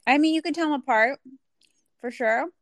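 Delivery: talking while eating popcorn; mouth full. Real, not acted. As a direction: speak around a mouthful of popcorn. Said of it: "Are you eating something?".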